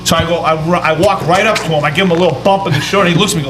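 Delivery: ordinary delivery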